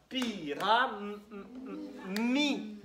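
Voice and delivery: "Pi, ra, m, m, m, mi" detached, M hummed; syllables sounded out. Shown as a demonstration. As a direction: hum repeated M sounds before landing on "mi". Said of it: "on va réfléchir à la troisième syllabe". (syllabes détachées, « m » fredonné avant « mi »)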